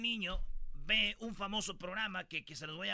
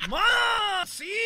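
put-on kid's voice; 10 semitones higher, 11 dB louder than usual. Kid reply.

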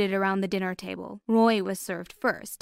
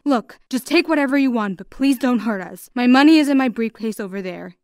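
louder, urgent plea; pleading; 3 semitones higher, 8 dB louder than usual. A narrator's reading. Father to armed men tying up his family, pleading. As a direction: plead with the intruders, pushing the volume. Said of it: "he pleaded".

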